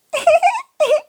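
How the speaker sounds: high squealing giggle; worm giggle. Acted. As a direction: giggle like a tickled little worm. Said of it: "plötzlich kicherte jemand".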